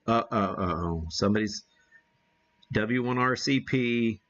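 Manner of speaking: usual speaking voice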